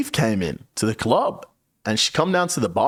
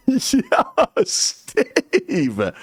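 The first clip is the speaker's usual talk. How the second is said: a giggly, higher hello to Steve; laughing greeting. Retold or imitated. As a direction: call out the name through a laugh. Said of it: "I was like".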